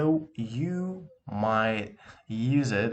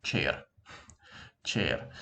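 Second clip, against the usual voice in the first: low "chair" in a careful Russian accent; accurate Russian accent; 7 semitones lower, 4 dB quieter than usual. Shown as a demonstration. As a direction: give "chair" a deliberate Russian pronunciation. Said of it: "let's be completely accurate".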